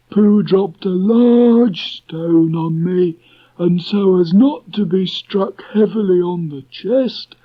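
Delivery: slow, high, gloomy Eeyore voice. A narrator's reading. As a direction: drag it out like a gloomy old donkey. Eeyore explains his dive.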